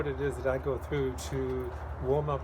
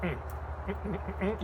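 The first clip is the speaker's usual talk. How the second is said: closed-mouth hums on a rising pitch; hummed warm-up; 4 semitones higher than usual. Shown as a demonstration. Singer demonstrating hums for a voice warm-up. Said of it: "You say".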